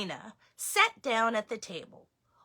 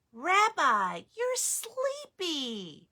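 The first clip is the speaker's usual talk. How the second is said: tender grandmother; 8 semitones higher, slower than usual. Acted. Baba Brena to the drowsy bear, slow tender. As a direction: coo it slowly, fondly.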